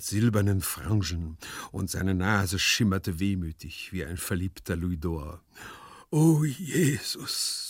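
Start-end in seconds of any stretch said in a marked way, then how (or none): 6.1-7.7 long drawn-out, higher sigh. A narrator's reading. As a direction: sigh the words out very slowly. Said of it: "seufzte er". (seufzend, lang gedehnt)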